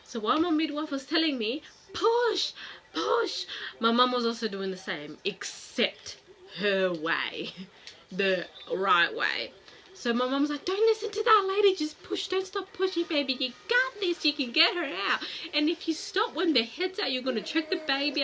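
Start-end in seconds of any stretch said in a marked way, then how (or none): 1.9-3.5 high loud "push, push"; imitated midwife urging. Midwife coaching the mother through delivery, high and loud. 6.6-9.5 a hint of the mum's voice, slow and low; mock African mum. Daughter mocking how her mum thinks, slow and low. 10.6-18.2 high, loud bossy mum urging; imitating her mum. Mum overruling the midwife in the delivery room, high and loud. African mum coaching in labor.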